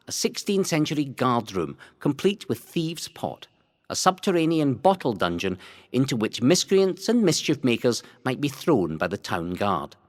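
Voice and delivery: ordinary delivery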